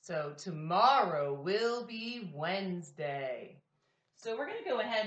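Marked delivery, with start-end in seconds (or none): 0.0-3.6 slow, loud, every word clear; slow model sentence. Shown as a demonstration. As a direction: say it slowly for the class to repeat. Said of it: "Repeat after me".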